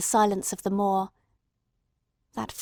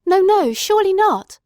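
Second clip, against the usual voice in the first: loud, much higher denial; anguished protest; 10 semitones higher, 8 dB louder than usual. A narrator's reading. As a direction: cry out against the news. Watson denying the worst.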